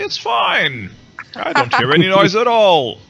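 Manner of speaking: high, airy, breezy impression; mocking a carefree friend. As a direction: chirp it out like nothing's wrong. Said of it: "she would say".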